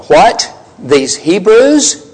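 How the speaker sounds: stunned, slow, rising "What? These Hebrews?"; as if the words just left them, slow and incredulous; speechless disbelief. Acted. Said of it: "Or maybe their speech just left them".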